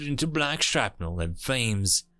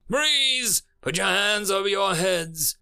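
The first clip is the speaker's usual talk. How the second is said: high, raised-voice police command; forceful, pitched-up demand; loud demand; 7 semitones higher, 3 dB louder than usual. A narrator's reading. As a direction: order them to halt over the sirens. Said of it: "a loud human voice demanded".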